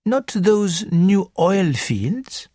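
put-on high, lilting French-accented gentleman; a fussy Belgian detective, high and precise; French accented little detective. Porrett speaks.

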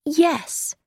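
understated, piercing yes; cutting yes. A narrator's reading. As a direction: let one word cut, without raising the voice. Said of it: "how she made that one word sound".

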